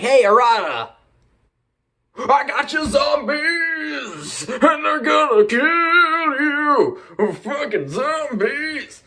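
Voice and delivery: high, slow shouted villain taunt; mock gleeful villain shout; hammed-up villain yell. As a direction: crow at the hero like a cartoon villain. Villain yells at Arata.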